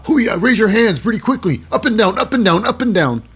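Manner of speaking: mock crowd hyping. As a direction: chant it to get hands moving.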